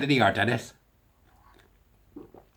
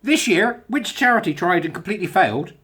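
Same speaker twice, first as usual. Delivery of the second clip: Mastermind quizmaster; 6 semitones higher, 3 dB louder than usual. Acted. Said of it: "rather like Mastermind".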